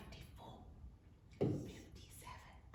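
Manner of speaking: barely audible whispered numbers; hushed counting prompt. Shown as a demonstration. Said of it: "I'll whisper it".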